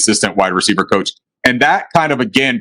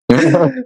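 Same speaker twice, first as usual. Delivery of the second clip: high nervous giggle; nervous laugh; 12 semitones higher than usual. Real, not acted. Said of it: "That was my nervous laugh".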